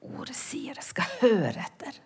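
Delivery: soft whisper. Retold or imitated. The Word telling her to listen, whispered. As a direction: hush it like a secret.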